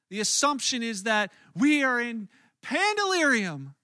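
usual speaking voice

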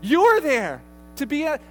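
loud, insistent pulpit delivery; emphatic preaching. Real, not acted. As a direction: drive it home at high volume. Preacher driving home his point.

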